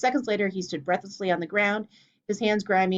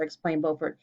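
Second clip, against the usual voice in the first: ordinary delivery, nothing marked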